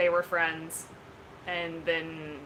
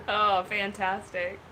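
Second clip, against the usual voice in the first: usual speaking voice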